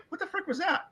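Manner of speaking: high, incredulous squeak of disbelief; a viewer caught off guard by a nude scene, squeaking in disbelief; mock shocked outburst. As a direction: play the stunned viewer, pitch jumping way up. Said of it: "You're like".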